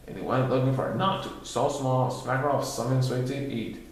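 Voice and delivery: ordinary delivery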